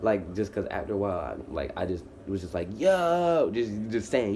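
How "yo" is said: loud, high retold holler; screaming. Detainee calling into the mic for attention, loud holler. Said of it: "just screaming in the damn mic".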